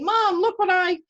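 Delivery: high, loud eager call; eager child. Retold or imitated. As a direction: burst in proud to show mom. Child runs up to mother.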